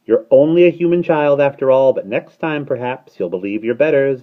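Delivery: high, loud starling voice; gruff bird. A narrator's reading. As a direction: console brusquely and condescendingly. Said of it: "said the starling gruffly".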